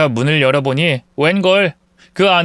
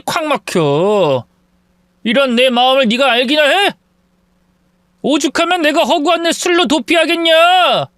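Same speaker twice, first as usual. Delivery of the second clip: shouting back; 9 semitones higher than usual. Acted.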